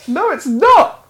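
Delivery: high, loud indignant protest. Real, not acted. Co-host arguing over how accent is said, loud indignant protest. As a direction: burst out in outraged disagreement. Mock argument over pronunciation.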